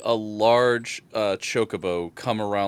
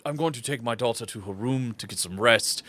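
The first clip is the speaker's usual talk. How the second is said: formal, hesitant father character; lightly voiced dignified father; obliging, courteous father. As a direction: agree readily, with polite stumbles. Game master plays the father.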